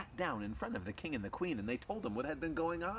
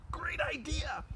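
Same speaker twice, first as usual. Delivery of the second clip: very high, excited squeal of agreement; excited dog; slower than usual. Acted. Dogs agreeing to play in the park, excited. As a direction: jump at the plan, high and breathy.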